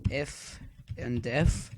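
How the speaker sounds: "if and def" spelled out slowly, word by word; slow dictation. Shown as a demonstration. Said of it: "what if and def means".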